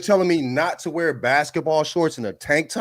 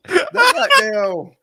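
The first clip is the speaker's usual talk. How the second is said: high, laughing outburst; cracking up mid-rant; 8 semitones higher, 3 dB louder than usual. Real, not acted. Laughing mock outrage.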